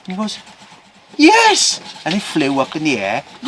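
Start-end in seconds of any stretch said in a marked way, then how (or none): 1.2-1.8 very high, loud yelp; astonished yes. Acted. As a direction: blurt it out, amazed he knows. Farmer's astonished third yes.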